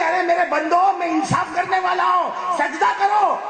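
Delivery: high shouted command; voice of God. Acted. God commanding servants to bow, shouted. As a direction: call out the summons to kneel. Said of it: "اللہ کہیں گے".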